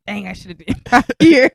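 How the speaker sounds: loud mock regret; regretful exclamation. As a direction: blurt it out with a laugh. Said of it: "and you'll be like".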